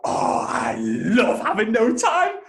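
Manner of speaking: high, loud, drawn-out fake rapture; mock delight. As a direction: gush absurdly, stretching every word. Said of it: "No one's ever gonna go".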